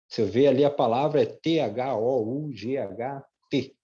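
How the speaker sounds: ordinary delivery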